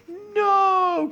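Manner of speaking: very high, loud long no; drawn-out wail of protest; crying out. A narrator's reading. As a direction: cry out in horror. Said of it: "cried the pair".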